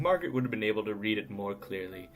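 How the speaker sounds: usual speaking voice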